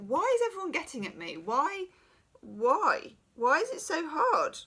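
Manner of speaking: high-pitched, fast, imitating a self-pitying wail; whiny plea of someone fed up; upset. Retelling own upset complaining.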